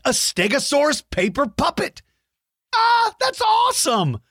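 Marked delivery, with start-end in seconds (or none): 2.7-4.2 very high, squealing delight. Real, not acted. Delighted by a paper puppet.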